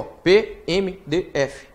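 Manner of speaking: slow "P M D F", letter by letter; drawn-out spelling. Shown as a demonstration. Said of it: "Então eu falei letra a letra".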